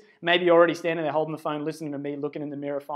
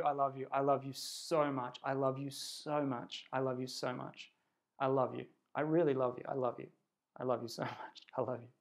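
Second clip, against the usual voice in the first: much softer, intimate I love you; very quiet affirmation; 4 semitones lower, 11 dB quieter than usual. Shown as a demonstration. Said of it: "I want you to say to yourself".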